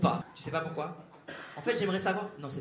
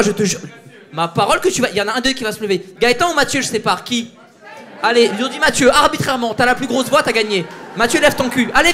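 louder, higher schoolteacher commands; mock strict teacher; 3 semitones higher, 5 dB louder than usual. Comedian separates two hecklers, mock teacher shouting. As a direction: order the troublemakers apart like a teacher.

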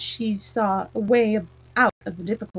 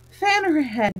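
raised, mildly anxious order; higher pitch, a touch of worry; 6 semitones higher than usual. A narrator's reading. Red Queen frets over an overthinking queen, mildly anxious. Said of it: "the Red Queen anxious".

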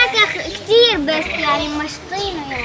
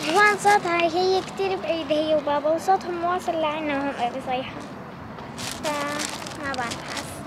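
hushed; 3 semitones lower, 9 dB quieter than usual. Real, not acted. Child whispering on the ride.

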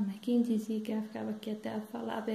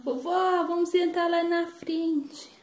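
put-on high, drawn-out child's plea; raised, slow singsong begging; eager granddaughter; 7 semitones higher, 4 dB louder, slower than usual. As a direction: pester Grandma like an eager kid. Granddaughter asks for front seats.

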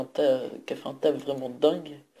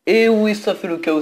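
louder, higher burst of joy; excited cheer; 5 semitones higher, 7 dB louder than usual. Real, not acted. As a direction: cheer as the move lands. The attack hits, he cheers.

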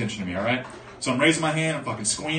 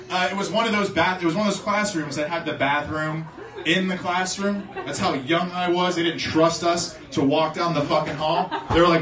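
ordinary talk

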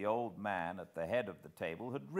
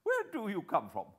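put-on higher, louder, scoffing young gentleman; incredulous guest; 8 semitones higher, 5 dB louder than usual. A narrator's reading. Guest challenges Hannay, scoffing and higher. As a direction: laugh off a madman's claim.

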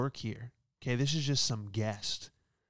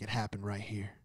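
much softer, low certain voice; whisper-confident conclusion; hushed detective; 3 semitones lower, 7 dB quieter than usual. Pretend. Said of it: "He was like".

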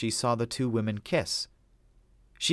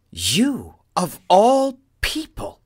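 louder, much higher, slow outraged demand; 11 semitones higher, 9 dB louder, much slower than usual. A narrator's reading. Adele confronting Drew over the video, loud outraged cry. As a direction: accuse him, shocked and hurt. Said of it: "she demanded".

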